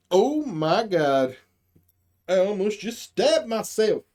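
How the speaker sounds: normal talking voice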